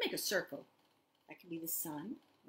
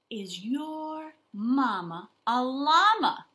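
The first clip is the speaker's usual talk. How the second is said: slow, louder title announcement; title read; 7 semitones higher, 11 dB louder, much slower than usual. Acted. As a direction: present the title grandly.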